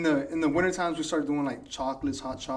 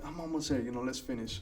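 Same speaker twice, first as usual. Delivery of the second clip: whispered self-talk; 3 semitones lower, 9 dB quieter than usual. Retold or imitated. Inner voice during marathon.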